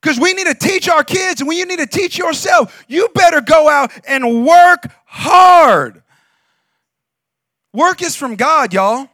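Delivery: slow, loud, rising preacher shout; full-force preaching. Acted. Pastor urging congregation to work hard, full-force preaching shout. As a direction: drive it home to the congregation.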